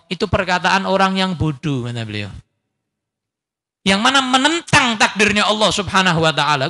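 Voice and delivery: ordinary talk, nothing marked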